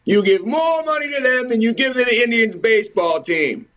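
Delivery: ordinary talk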